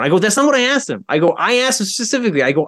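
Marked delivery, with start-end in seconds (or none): 0.2-1.0 high-pitched, insistent pushback; re-enacted exasperated objection; retold indignant correction. 1.4-2.4 quick, high-pitched correction; re-enacted exasperated objection; retold indignant insistence.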